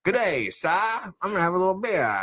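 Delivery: a lightly done Australian gent; mock Australian accent. Mocking a monocled gent in an Australian accent. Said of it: "your guy Australian".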